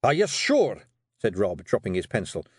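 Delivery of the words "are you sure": loud, high Scottish question that drops at the end; startled question. Acted. Rob dropping his pencil at the news, startled. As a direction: burst out, taken aback.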